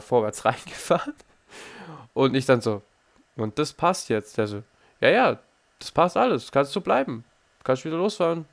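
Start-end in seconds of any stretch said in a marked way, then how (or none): 5.0-8.5 higher mock instructor, breezy; easygoing instructor. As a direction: wave it through like a relaxed teacher. Fahrlehrer nachgemacht. (höher nachgeäffter Fahrlehrer, locker)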